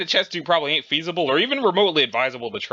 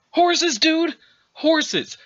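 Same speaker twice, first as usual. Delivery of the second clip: higher, shouted enthusiasm; excited yell; 11 semitones higher, much slower than usual. Acted. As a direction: whoop about horses. Muscle character yelling about horses.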